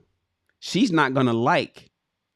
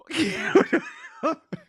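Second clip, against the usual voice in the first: short high burst of laughing; real laughter; 13 semitones higher than usual. Real, not acted. Reader still tickled by Ace's comment, high laughter.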